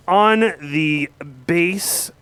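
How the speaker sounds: slow, stretched band intro; announcer intro. Acted. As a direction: draw it out like a stage MC.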